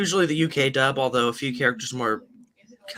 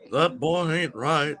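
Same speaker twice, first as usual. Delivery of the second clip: Hank Hill impression; 3 semitones higher, slower than usual. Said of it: "the best Thomas character, Hank Hill".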